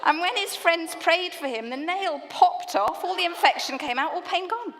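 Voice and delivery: full-on excited storytelling; dramatic retelling. Real, not acted. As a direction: tell it big and breathless with laughter. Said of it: "Slightly less dramatically".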